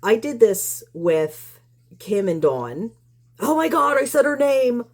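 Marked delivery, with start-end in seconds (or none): 3.4-4.8 high-pitched, sarcastic fake shock; exaggerated pretend outrage, pitched way up; mock horror. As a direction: gasp it out as if scandalized. Mocking a critic's outrage.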